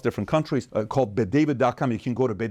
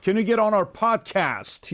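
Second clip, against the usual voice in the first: high-pitched, hammed-up excitement; overeager novice host; 8 semitones higher than usual. New podcaster begging a star for an interview, mock-eager. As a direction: gush as if hailing a superstar. Mimics novice chasing famous guests.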